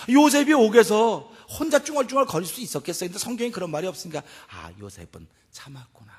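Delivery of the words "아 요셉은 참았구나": very soft, low musing; quiet, low dawning understanding; hushed realization. Acted. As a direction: let it sink in, almost to yourself.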